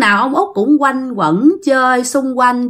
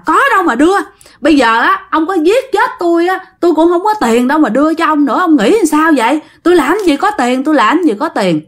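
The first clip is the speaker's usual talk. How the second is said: screaming wife; 6 semitones higher than usual. Acted. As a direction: shriek back that there is no money. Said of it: "bà này mới gào, mới la lên".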